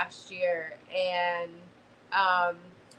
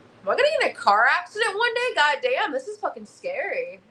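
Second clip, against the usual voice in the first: put-on scared kid's thoughts; 6 semitones higher than usual. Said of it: "a little scared kid".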